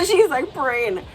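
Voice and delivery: laughing through the words; amused laugh in the voice. Real, not acted. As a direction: giggle while describing her. Laughing at daughter praying.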